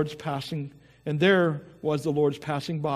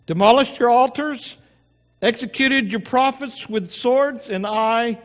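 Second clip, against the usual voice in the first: rehearsed complaint; 6 semitones higher, 4 dB louder than usual. Acted. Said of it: "Almost sounds like he had been rehearsing this".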